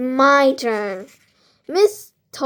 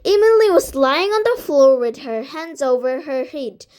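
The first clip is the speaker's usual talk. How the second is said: plain reading voice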